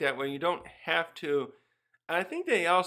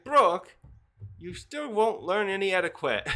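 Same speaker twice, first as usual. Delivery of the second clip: higher, louder scold; 4 semitones higher, 4 dB louder than usual. Acted. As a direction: tell him off sharply. Scolding the prince.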